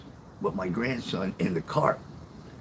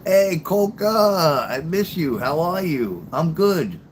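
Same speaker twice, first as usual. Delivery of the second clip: high, loud, drawn-out hello; long stretched vowels, happily calling out; delighted greeting; 7 semitones higher, 5 dB louder, much slower than usual. Real, not acted. Greeting a viewer who dropped in.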